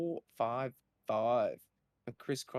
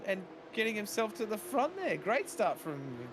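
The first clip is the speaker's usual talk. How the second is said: high, excited race call; motorsport commentator; 8 semitones higher than usual. Acted. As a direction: call the race start live. Live race play-by-play.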